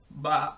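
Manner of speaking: imitated farmyard baa; sheep bleat. A narrator's reading. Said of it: "said the sheep".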